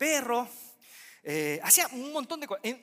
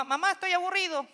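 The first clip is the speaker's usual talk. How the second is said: put-on whiny child; 10 semitones higher, faster than usual. Child voiced complaining to mother.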